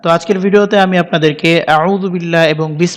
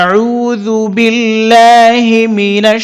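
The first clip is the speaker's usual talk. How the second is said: slow, melodic Quranic recitation; much slower than usual. Shown as a demonstration. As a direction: chant it slowly in a tajwid style. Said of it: "এভাবে আবার বলে দিচ্ছি". (ধীরে সুরেলা তিলাওয়াত)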